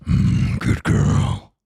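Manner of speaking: breathy whispered dom voice, hammed up; mock a smug dominant guy purring it. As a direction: parody of a dom whispering good girl. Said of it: "more guys going like".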